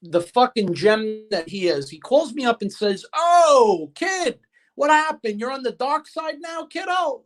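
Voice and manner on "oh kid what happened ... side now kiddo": imitating a friend's high, drawn-out ribbing; playful retold greeting, pitched way up; mock-accusing tease. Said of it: "I'm just messing with you".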